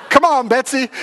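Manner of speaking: playful, giggly call to a cow; mock cow rider. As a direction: jokingly spur the cow on. Urging a cow in battle.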